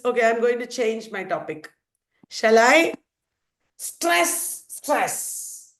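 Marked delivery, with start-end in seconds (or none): 3.8-5.7 "Stress, stress" as two drawn-out, separate beats; first "Stress" pitched high, second falling; two equal stresses. Shown as a demonstration. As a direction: give each "stress" its own long hissing beat. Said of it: "What is the syllable called?".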